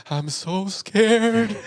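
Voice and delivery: high, very slow mock-scared whisper; Blair Witch parody. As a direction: drag it out like a scared found-footage girl. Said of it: "a Blair Witch, like a few seconds of a parody".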